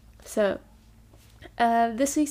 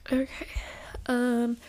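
whispered to herself; whispering. Real, not acted. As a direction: murmur it in a whisper. Aside while searching laptop.

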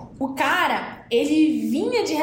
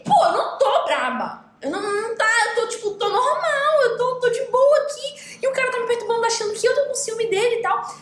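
mock irritated girlfriend; 8 semitones higher than usual.

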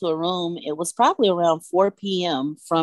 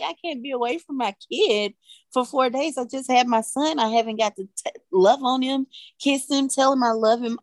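distressed pleading; 8 semitones higher than usual. Retold or imitated. Begging doctor to leave hospital.

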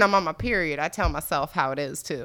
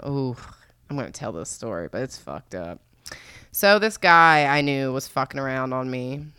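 usual speaking voice

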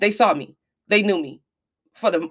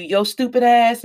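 raised-pitch retold holler; mock yelling; 6 semitones higher, 5 dB louder, slower than usual. Daughter imitates her mother hollering insults, mock yelling. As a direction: imitate a mother's verbal abuse, louder. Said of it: "she would be hollering, yelling, screaming".

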